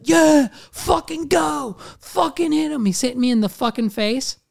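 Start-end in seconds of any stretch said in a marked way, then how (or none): 0.0-2.8 high, stretched-out mock cheering; hyped-up imitation of rowdy onlookers; imitating egging-on crowd. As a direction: act out a crowd goading a fighter. Acts the crowd egging on fight.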